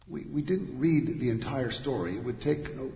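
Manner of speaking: ordinary talk, nothing marked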